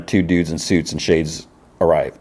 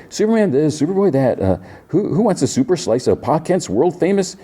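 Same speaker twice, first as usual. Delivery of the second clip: higher, bright, forced cheer; nervous cheerful deflection; 10 semitones higher than usual. Retold or imitated. Jimmy steps between the two Supermen, high nervous cheer. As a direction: chirp it up to calm a tense standoff.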